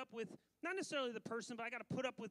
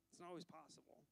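soft; 3 semitones lower, 11 dB quieter than usual. Real, not acted. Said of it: "unity's not always possible".

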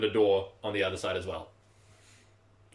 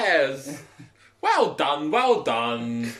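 loud, raised creature voice; delighted creature; 5 semitones higher, 6 dB louder, much slower than usual. Acted. Creature congratulating whoever opened the door, delighted and loud. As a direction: cheer them on as an excited creature.

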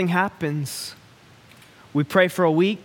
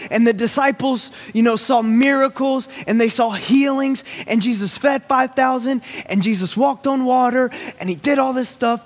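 preaching; 5 semitones higher than usual. Acted. Pastor rallies church about disciples, rising preacher voice. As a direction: climb in pitch and push the list.

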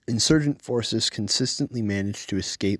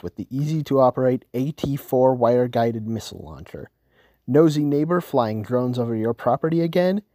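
upbeat, louder commercial pitch; ad read; 3 semitones higher, 4 dB louder than usual. Acted. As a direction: sell it like a TV ad. Sponsor ad read in newscast.

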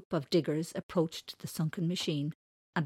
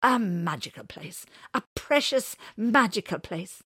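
loud, higher exasperated grumble; angry grumble; 4 semitones higher, 8 dB louder than usual. Acted. Old man cuts off talk of Thorny Wood, angry grumble. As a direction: repeat it with irritated scorn. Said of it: "grumbled the old man with an angry sigh".

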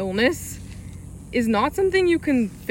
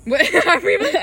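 laughing; 8 semitones higher, 6 dB louder than usual. Real, not acted. Laughing mid-sentence.

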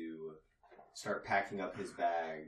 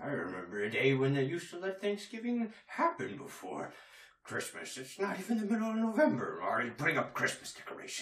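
higher, speeding up, indignant complaint; grumbling old-timer; 8 semitones higher, 6 dB louder, faster than usual. Acted. Father Time complains to the Chinese New Year rep, rising indignant. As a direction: gripe like a cranky elder. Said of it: "just say".

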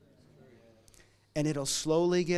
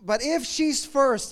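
ordinary delivery

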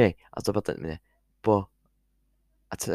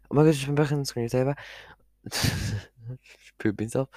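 ordinary talk, nothing marked

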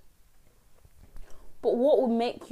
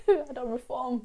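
laughing; 6 semitones higher than usual. Retold or imitated. Retelling own reply to doctor.

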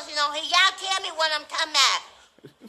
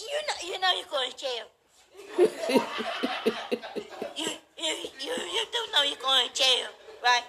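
mock him while giggling; laughing taunt; 5 semitones lower, 5 dB quieter than usual. Real, not acted. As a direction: lower and softer, laughing through the taunt.

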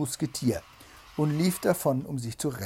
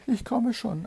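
high-pitched wife calling back; placating wife; 10 semitones higher, 3 dB louder than usual. A narrator's reading. Wife answers Bluebeard's shout, high and placating.

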